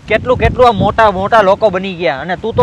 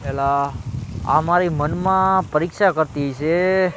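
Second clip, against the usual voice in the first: quiet aside; 6 semitones lower, 8 dB quieter, slower than usual. Acted. Aside to himself, scheming.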